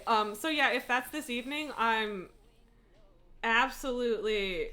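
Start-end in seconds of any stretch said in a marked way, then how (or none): none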